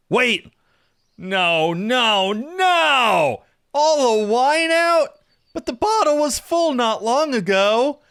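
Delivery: high, drawn-out wail of dismay; panicked yell that stretches into a wail; dismayed shouting. Acted. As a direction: cry out in loud, overblown despair. Despair over the empty bottle.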